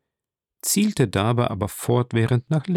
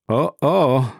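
higher, mildly startled exclamation; a touch of mock shock; exclaiming; 4 semitones higher, 3 dB louder than usual. A narrator's reading. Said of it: "rief Monte Cristo".

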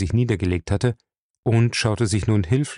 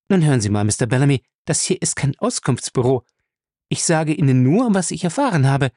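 raised, insistent character voice; impatient retort; 7 semitones higher than usual. Acted. Answering Bellamy's many questions about Smith, impatient. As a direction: cut off the questioning, slightly exasperated.

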